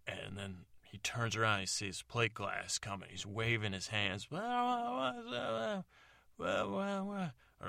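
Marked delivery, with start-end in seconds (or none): 4.3-7.3 very high, drawn-out "well, well"; high singsong taunt. Acted. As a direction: sing it up high and mocking.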